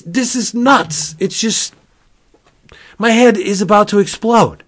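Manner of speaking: high-pitched, incredulous wonder; overwhelmed amazement. Real, not acted. Fan stunned by the King of Cartoons reference, overwhelmed amazement. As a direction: pitch it high, pausing as words fail you.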